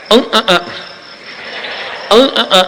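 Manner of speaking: high nasal humming, imitating a man who can't speak clearly; mock mute man humming his name. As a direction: hum it high through the nose. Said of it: "એ આવો હતો ગૂંગણો".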